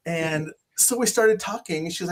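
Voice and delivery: ordinary talk, nothing marked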